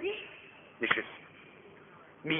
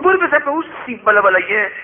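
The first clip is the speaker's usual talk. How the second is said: mocking dismissal; 8 semitones higher, 4 dB louder, faster than usual. Rich man dismisses beggar.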